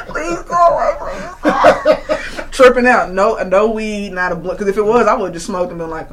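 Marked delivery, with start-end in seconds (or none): 0.0-2.0 high pleading words broken by laughter; laughing plea. Retold or imitated. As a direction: beg it to go away while laughing. Begging insomnia to leave, laughing.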